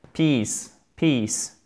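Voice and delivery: "Peace, peace" with lengthened "ee"; long i. Shown as a demonstration. Teacher exaggerating length for learners, long i. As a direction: hold each vowel in peace deliberately long. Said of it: "J'exagère sur la longueur".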